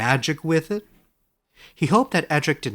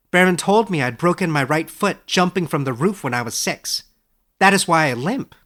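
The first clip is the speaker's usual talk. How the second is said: Edric's voice; 5 semitones higher, 6 dB louder than usual. Acted. Young scholar asks his old teacher, amazed and higher. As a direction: ask it wide-eyed, a step higher.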